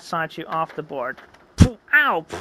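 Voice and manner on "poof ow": high sudden ow; cartoon crash and yelp. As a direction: yelp as you hit the ground.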